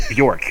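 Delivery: "Bjork" said as "BYORK"; correct Bjork. Shown as a demonstration. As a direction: say "Bjork" in one syllable with a y glide.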